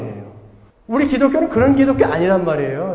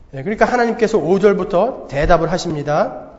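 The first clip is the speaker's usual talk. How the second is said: normal talking voice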